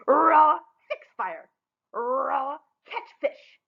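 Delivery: a mild mock roar of chores; roaring orders. Stepsisters ordering Rella around, mild mock roar. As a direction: bark the chores at her. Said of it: "they roared at her".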